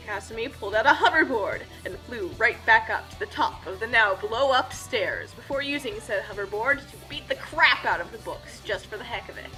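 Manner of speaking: usual speaking voice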